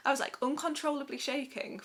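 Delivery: choked up and teary, then a shaky laugh; welling up mid-story, voice wavering; getting emotional. Real, not acted. Recounting shaking in labour, tears rising. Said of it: "I'm getting emotional already".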